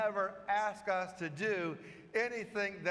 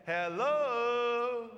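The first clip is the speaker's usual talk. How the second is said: calling out; 5 semitones higher, 5 dB louder than usual. Retold or imitated. Father calls out across valley.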